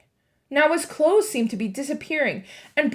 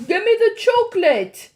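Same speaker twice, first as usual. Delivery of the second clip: higher, louder demanding shout; Bruno shouting; 10 semitones higher, 8 dB louder than usual. A narrator's reading. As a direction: shout for the chocolate. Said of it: "shouted Bruno".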